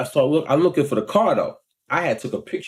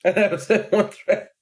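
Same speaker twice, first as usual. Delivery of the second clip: higher-pitched words through a laugh; 5 semitones higher than usual. Real, not acted. Denying he threatened the driver, real laughing delivery. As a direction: chuckle through the protest. Laughs while repeating his defence.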